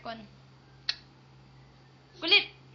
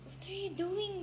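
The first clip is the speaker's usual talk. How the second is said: ordinary delivery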